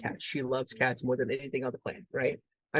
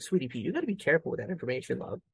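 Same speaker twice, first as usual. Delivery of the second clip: fast gentle warning; faster than usual. Retold or imitated. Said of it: "I'm like".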